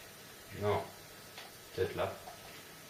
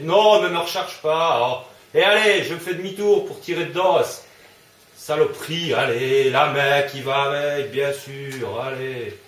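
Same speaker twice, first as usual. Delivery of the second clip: frustrated yelling; 4 semitones higher, 7 dB louder than usual. Real, not acted. Frustrated yelling mid-fight.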